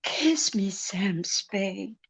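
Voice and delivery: breathy seductive whisper; femme fatale. Acted. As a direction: whisper it, pulling him close.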